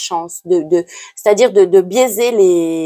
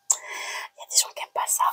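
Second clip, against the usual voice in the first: whisper; 15 dB quieter than usual. Real, not acted. Hushed aside mid-reading.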